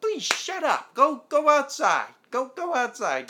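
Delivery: high, loud exasperated telling-off; mock exasperated order. Acting out Larry to the crying woman, mock exasperation. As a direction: imitate someone fed up, ordering her out. Acted annoyed mourner shushing crier.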